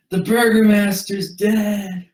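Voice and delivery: high, loud frantic cry; panicked shout. Acted. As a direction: yell it out in panic. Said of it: "He says".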